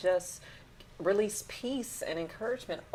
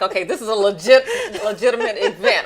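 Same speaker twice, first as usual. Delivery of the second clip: a burst of laughing glee, louder and higher; excited; 5 semitones higher, 12 dB louder than usual. Retold or imitated. Radio host reliving the thrill of seeing her name, laughing and excited. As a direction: bubble over with delight at the news. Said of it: "I get really excited".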